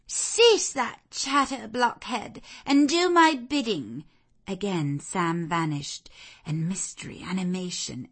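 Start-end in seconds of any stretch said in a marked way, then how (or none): 0.1-4.0 high, loud haughty rebuke; imperious scolding. Acted. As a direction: silence the servant and demand obedience. Blanche scolds the servant.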